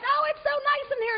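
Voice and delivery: put-on very high, sweet girly voice; mock-dainty high voice; dainty flower. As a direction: put on a precious, delighted squeak. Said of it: "one of them's like".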